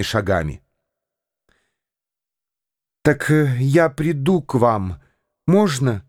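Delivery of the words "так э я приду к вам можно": plain reading voice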